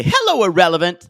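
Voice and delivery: loud, brassy quoted greeting; punchy mock greeting, projected; catchphrase. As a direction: call out the catchphrase loudly. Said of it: "if you've ever heard us say".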